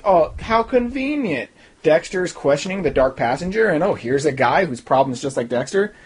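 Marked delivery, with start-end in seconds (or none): 0.1-1.5 slow, high sarcastic drawl; mock amazement. Reviewer scoffs at a too-neat plot, slow high sarcasm. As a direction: draw it out high in pretend wonder.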